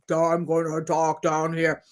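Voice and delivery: low, dopey put-on voice; mock dumb deep voice. Host mocking a fake deep voice, low and dopey. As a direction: play a lunk talking down low. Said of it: "not like deep in the sense of".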